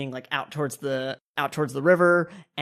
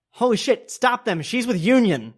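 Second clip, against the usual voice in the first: high, loud cry of alarm; urgent, raised-voice warning; alarmed shout; 7 semitones higher, 5 dB louder than usual. Acted. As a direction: order them stopped in sudden alarm. Villain raises alarm as doors close.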